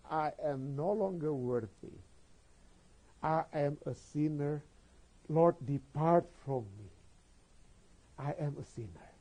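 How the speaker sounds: slow, subdued voice of an unworthy penitent; dejected confession. Acted. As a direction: confess it slowly, weighed down by guilt. Voicing Peter feeling unworthy. (pengakuan pelan dan murung)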